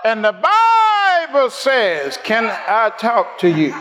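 high, stretched sermon cadence; impassioned pulpit call; fervent preaching. Real, not acted. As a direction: draw out the phrases to rouse a congregation. Preaches at full force.

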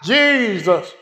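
high, stretched sermon cadence; impassioned pulpit call; fervent preaching. Real, not acted. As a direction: draw out the phrases to rouse a congregation. Preaches at full force.

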